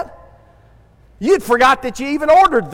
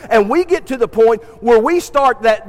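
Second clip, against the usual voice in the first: ordinary talk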